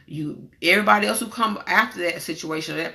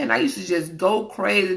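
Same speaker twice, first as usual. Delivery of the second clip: ordinary talk, nothing marked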